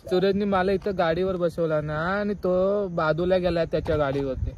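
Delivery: ordinary talk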